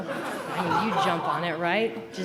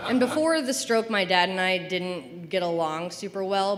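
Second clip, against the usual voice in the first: ordinary delivery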